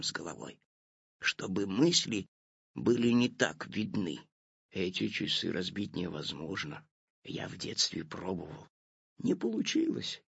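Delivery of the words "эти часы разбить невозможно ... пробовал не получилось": soft, low whispered admission; hushed confession whisper. A narrator's reading. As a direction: confess it in a hush. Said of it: "шёпотом признался папа".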